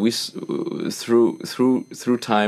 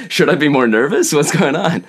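mock nervous; 7 semitones higher, 5 dB louder than usual. Voices his own nervous inner reaction.

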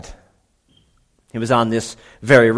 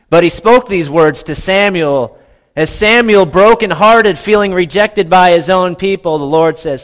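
higher and louder, fervent build; impassioned preaching; 7 semitones higher, 8 dB louder than usual. Real, not acted. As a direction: preach it with rising fire.

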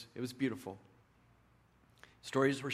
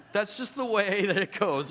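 laughing; 8 semitones higher, 7 dB louder than usual. Real, not acted.

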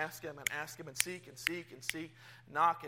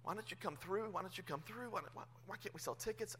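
desperate prayer; 13 dB quieter than usual. Retold or imitated. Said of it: "crying out to God".

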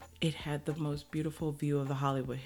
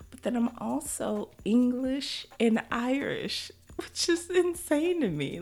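amazed disbelief; 10 semitones higher, 3 dB louder than usual. Real, not acted. Telling listeners her ancestry results, amazed and much higher. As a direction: pitch jumps much higher, real amazement.

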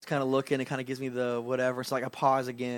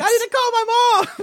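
put-on high whiny protesting kid; 10 dB louder, faster than usual. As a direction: squeak it out like a caught kid. Kid voice in retold scene.

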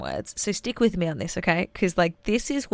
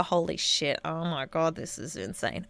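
hushed, low disbelief; overwhelmed disbelief; 4 semitones lower, 8 dB quieter than usual. Acted. Podcaster imagining her future reaction to this episode, hushed amazement. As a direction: let it sink softly, trailing away in awe. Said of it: "I'm gonna really feel it".

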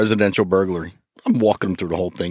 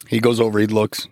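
usual speaking voice

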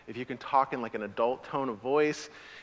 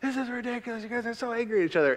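mock yell at kids; 7 semitones higher, 3 dB quieter, faster than usual. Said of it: "And so I yelled at them".

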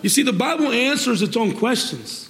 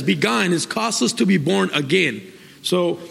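normal talking voice

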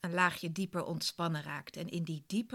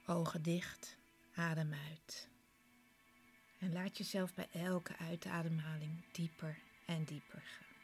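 guided meditation. Acted. Leads a guided relaxation.

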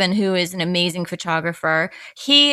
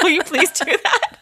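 eager plea; 9 semitones higher than usual. Retold or imitated.

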